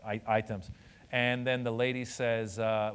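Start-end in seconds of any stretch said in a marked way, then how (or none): 0.0-0.7 lower, Iranian-accented imitation of mom; quietly matter-of-fact Iranian accent; mom's Iranian accent. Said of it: "has the accent, and she talks like this".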